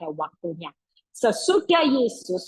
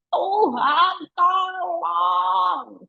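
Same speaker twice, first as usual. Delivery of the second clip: earnest cry; 7 semitones higher, much slower than usual. Shown as a demonstration. Preacher showing a dying man's real cry. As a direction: cry out to God in need. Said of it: "segenap teriak".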